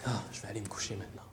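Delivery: a quick, breathy whisper; fast sleepy whisper. Acted. Believer ending a lazy prayer, whispering fast toward bed. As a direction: rush it out in a whisper, ready for bed.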